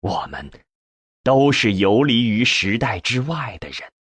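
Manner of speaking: usual speaking voice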